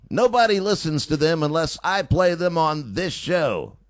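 energetic radio outro; radio sign-off. Real, not acted. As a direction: punch out the station ID. Host signs off into the break.

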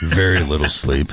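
sleepy late night radio host. Pretend. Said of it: "I'm gonna have that late night radio host voice".

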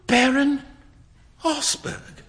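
high, breathless laughing disbelief; laughing in dismay. Acted. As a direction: laugh through the shock of it. Hughie learns beggar was baron.

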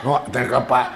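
ordinary delivery

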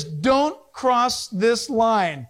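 slow, deliberate warning, word by word; spaced-out, emphatic command; stern warning sign. Acted. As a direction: read the sign out slowly and firmly.

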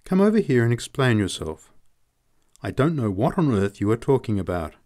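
ordinary delivery

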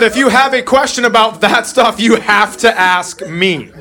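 slow, high and sincere; dad warning his son; earnest dad advice. Retold or imitated. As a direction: lay it down gently but firmly. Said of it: "I said".

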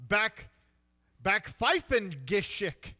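slow hammy German; drawn-out German word; mock German accent. Shown as a demonstration. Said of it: "Say it one more time".

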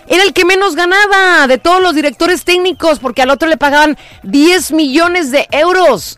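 voz aguda y exaltada; asombro acalorado. Real, not acted. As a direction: suelta la comparación con escándalo. Indignada por la diferencia de sueldos. (escandalizada, en tono muy alto)

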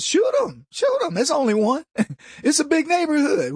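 very high, playful shrug-off; mock indifference. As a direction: egg them on in a high comic voice. Neighbours shrugging off the hostage threat.

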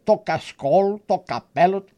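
ordinary delivery, nothing marked

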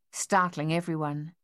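ordinary delivery, nothing marked

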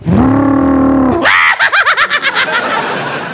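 loud, high, drawn-out cackle; long mischievous cackle. Acted. Driver gleefully cutting off another car, loud cackle. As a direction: cackle on and on, loudly.

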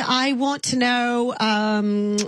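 lightly put-on English accent calling a stranger "love"; sweet English caller, accent worn lightly; British accent. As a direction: play a chatty Englishwoman with a mild accent. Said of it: "I'm from England".

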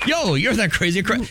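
a hint of Chef again; Chef impression. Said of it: "First quote is".

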